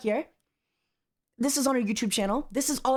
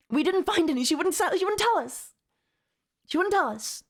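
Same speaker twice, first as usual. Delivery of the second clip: higher, rising frustration; worked-up rant; 7 semitones higher than usual. Real, not acted. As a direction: let the frustration boil over. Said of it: "I'm getting a little worked up".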